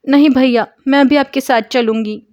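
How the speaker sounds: loud, high refusal to stay behind; tearful insisting. Acted. Lakshmi insists to Murthy she will go, loud. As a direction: insist on going, refusing firmly. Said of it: "Lakshmi जिद कर रही थी".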